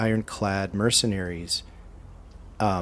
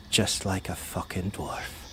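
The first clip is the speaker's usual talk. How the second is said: overheard remark; 2 semitones lower, 8 dB quieter than usual. Acted. Cudgel comments as the other walks away, softly under her breath. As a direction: say it under your breath, softer than normal. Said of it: "you can hear her say".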